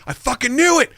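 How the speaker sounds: high, punchy 'called it' exclamation; triumphant retold outburst. Camera guy calling out the fake accent, triumphant.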